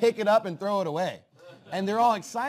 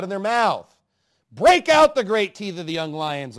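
forceful scripture reading; 7 dB louder than usual. Acted. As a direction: thunder the verses at the congregation. Reads the psalm at full force.

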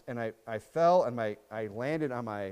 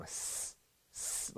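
quiet unvoiced hiss; 14 dB quieter than usual. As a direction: hiss like a stranger across the street. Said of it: "literally go".

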